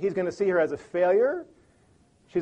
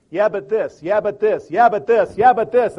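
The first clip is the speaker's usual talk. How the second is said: building from firm to loud, each yeah harder; getting louder; 5 semitones higher, 10 dB louder than usual. Shown as a demonstration. Arguing spouse, voice climbing louder with each yeah-but. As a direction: argue back louder every time. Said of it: "the voice is getting louder".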